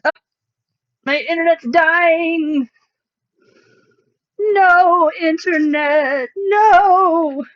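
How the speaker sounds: slow, high, drawn-out wail; mock wail. Streamer to the dying internet, mock wail. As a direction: plead with it in a long, rising mock cry.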